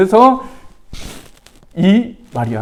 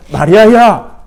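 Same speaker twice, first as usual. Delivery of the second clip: gently calling out 마리아야, a little louder; tender call; 6 semitones higher, 7 dB louder, slower than usual. Retold or imitated. Jesus tenderly calling Mary by name, affectionate. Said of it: "이렇게 정답게 불러주시는".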